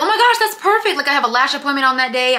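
high, loud gushing joy; excited. Retold or imitated. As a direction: burst out thrilled and chirpy. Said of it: "I was like".

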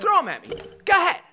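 punchy, insistent retold dare; goading dare. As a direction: egg him on to do it. Retold bully shoves and dares kid.

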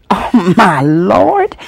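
slow, drawn-out oh my Lord; mild dismay. Retold or imitated. Granddaddy seeing the naked child, slow dismayed sigh. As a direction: let it out in slow disbelief.

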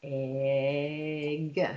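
"Egg" stretched out low on a held vowel; said slowly. Shown as a demonstration. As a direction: draw out each sound of "Egg". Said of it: "Say egg slowly".

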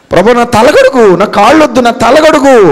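high, loud begging cry to his master; Peter's desperate plea. Acted. Peter begging Jesus not to wash his feet, desperate high plea. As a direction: plead as if it breaks your heart.

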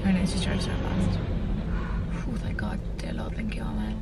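hushed worry to whispered relief; scared then relieved. Real, not acted. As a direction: whisper a panicked then grateful prayer. FedEx van may be theirs.

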